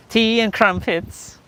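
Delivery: slow, high, hammed-up British accent with a laugh; mock British accent. Vlogger jokes about what British sounds like, in a bad mock British accent. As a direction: stretch out "Tea and crumpets" in a joking British accent. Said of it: "I don't know how to do a British accent".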